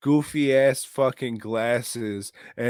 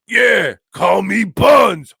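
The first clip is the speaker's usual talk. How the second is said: mock shout; 9 semitones higher, 12 dB louder, slower than usual. Goofy character shouting his nickname.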